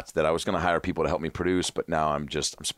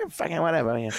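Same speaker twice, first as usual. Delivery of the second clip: dismissive Burr; 10 semitones higher than usual. Retold or imitated. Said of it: "He's like".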